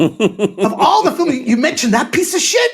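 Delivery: very high, loud exasperated rant; Robin Williams, losing it. Retold or imitated. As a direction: imitate a star's ego-bruised meltdown, stretching the words. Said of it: "I lost my shit".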